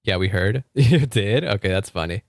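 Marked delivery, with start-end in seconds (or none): none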